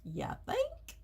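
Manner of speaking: high, squeaky, sarcastic retort; mock the obvious with a high squeal; sarcastic quip. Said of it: "I was like".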